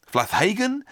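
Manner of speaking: Flathagen said as FLATH-HAY-gen, with th and a long "hay", rising; high, unsure Flathagen with "hay" in the middle; second pronunciation attempt. Shown as a demonstration. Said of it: "I hope one of those is correct".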